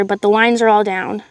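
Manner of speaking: ordinary delivery, nothing marked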